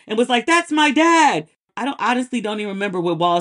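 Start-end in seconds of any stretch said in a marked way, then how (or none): 0.4-1.5 higher, louder, slow tearful "That's my dad"; mock the proud son's choked-up cry; imitating a sobbing son. Host imitating the son's tearful pride at the convention. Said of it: "sobbing really".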